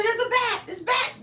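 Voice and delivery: high-pitched mock alarm; re-enacted panicked yell. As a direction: call out a warning, acting out panic. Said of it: "I'm yelling".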